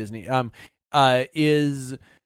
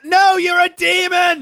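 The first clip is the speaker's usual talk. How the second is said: high, drawn-out hammed-up shriek; mock scream; 4 dB louder, slower than usual. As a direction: scream it like a panicked medieval fairgoer. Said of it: "You start screaming at it".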